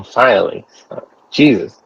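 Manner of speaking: ordinary delivery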